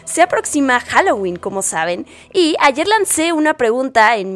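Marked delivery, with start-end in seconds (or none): none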